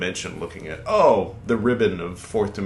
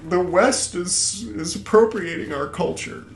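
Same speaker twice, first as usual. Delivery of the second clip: higher, slow mocking voice of a whiner; mock complaint; 11 semitones higher, 3 dB louder than usual. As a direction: parody the aggrieved Greeks. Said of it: "so they're like".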